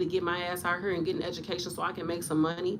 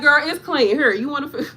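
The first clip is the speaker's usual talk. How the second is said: loud, higher, fed-up insistence; exasperated nurse; 5 semitones higher, 8 dB louder than usual. Retold or imitated. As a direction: insist loudly that it's already clean. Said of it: "I be like".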